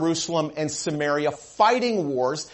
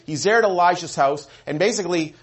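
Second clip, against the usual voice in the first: normal talking voice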